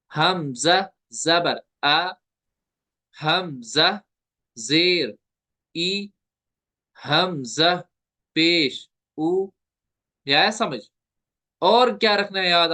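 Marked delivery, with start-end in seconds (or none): 0.1-9.5 हमजा जबर आ, हमजा जेर ई, हमजा पेश उ slowly, word by word; measured spelling, vowels neither stretched nor jerked; gentle Arabic reading. Shown as a demonstration. Teacher modelling all three vowels, slow and measured. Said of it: "खींचेंगे भी नहीं".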